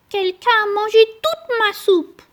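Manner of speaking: put-on squeaky baby bear, upset; petit ours. A narrator's reading. (petite voix aiguë du petit ours)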